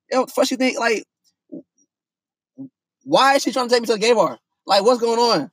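high, baffled and rushing, a touch of panic; retold alarm at where she is taking him; incredulous.

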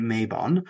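"Mabon" as MAY-bon; "Mabon" said MAY-bon, long first vowel; mispronounced name. Shown as a demonstration. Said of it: "still closer than Mab-, name Mabon".

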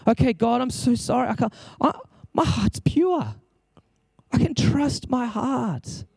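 acted hesitant prayer.

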